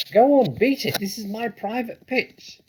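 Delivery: very high, loud shriek; shrieking rat. A narrator's reading. Rat shrieks at the fox to go away. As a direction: screech it like a furious rat. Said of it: "shrieked the rat".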